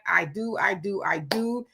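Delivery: ordinary delivery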